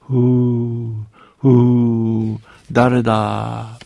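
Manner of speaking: a huge voice breathing out of a cave, slow on the last word; booming giant voice. A narrator's reading. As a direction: blow out each ふー like wind, then ask who it is. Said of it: "声のようなものに変わり始めました".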